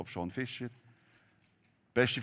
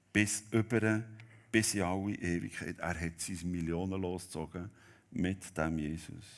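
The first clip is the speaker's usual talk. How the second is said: ordinary talk, nothing marked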